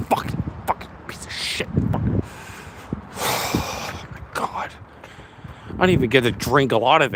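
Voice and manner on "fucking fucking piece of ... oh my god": whispered swearing under his breath; muttered curses. Real, not acted. Dropped his wine, muttering curses to himself in a whisper. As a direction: hiss it out in despair.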